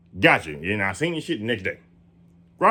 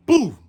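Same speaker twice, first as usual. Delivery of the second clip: a loud, high imitated boom; mock thunder boom; 5 dB louder than usual. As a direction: boom it out like the strike hitting. Imitating the lightning strike.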